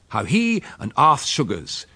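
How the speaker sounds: ordinary delivery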